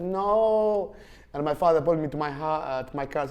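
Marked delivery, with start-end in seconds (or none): 0.0-0.9 goat refusing. Retold or imitated. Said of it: "She said".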